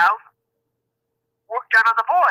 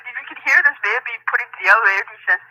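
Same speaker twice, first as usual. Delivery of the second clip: ordinary talk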